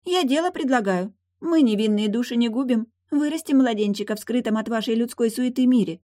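high-pitched mermaid making her case; offended mermaid. Acted. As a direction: argue it in a high voice.

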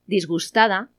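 ordinary talk, nothing marked

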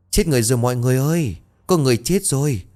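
high, fast alarmed shouting; panicked scream. Acted. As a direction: cry out in terror at the body. Servant screams discovering corpse. (hét thất thanh hoảng loạn)

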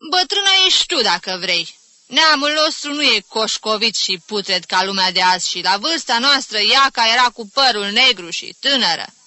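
loud, high, indignant outburst; offended retort. Acted. Aunt livid at being called old, indignant retort. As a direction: hit back at the insult. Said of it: "deveni vânătă ca o meduză".

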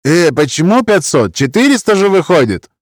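high, indignant haggling voice. A narrator's reading. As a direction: object to the sum in a high voice.

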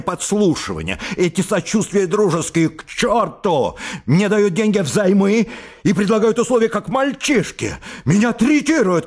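high, strained cry near hysteria; high overwrought shout; shouting in agitation. A narrator's reading. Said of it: "крикнул Лаевский, шатаясь от волнения".

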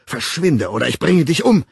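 loud fury, rising to the end; roared threat. A narrator's reading. Man towering over the woman, roared threat. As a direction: threaten with building force. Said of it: "brüllte er".

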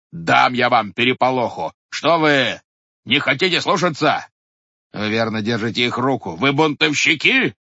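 loud, indignant scolding; furious head. A narrator's reading. Head berating the watchmen, loud and indignant. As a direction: berate the disobedient men.

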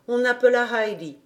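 ordinary delivery

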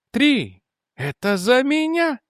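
high, drawn-out deranged voice; mad murmur to himself. A narrator's reading. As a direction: say it high, as a private crazed secret. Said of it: "прошептал Нильсон". (высоким голосом, безумно, про себя)

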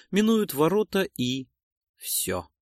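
ordinary delivery

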